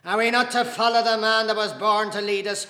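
loud, high indignant question; heated challenge. Acted. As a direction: demand an answer at full voice. Casey arguing heatedly with Dante.

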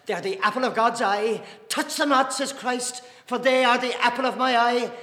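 angry, high, loud interruption building in force; Dante. A narrator's reading. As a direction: defend the priests with rising fury. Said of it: "Dante broke in angrily".

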